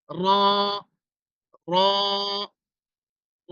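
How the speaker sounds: র with the lips left natural, not rounded; Arabic ra, lips relaxed. Shown as a demonstration. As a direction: say র slowly three times, lips still. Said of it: "ঠোঁটটা স্বাভাবিক রাখবেন।".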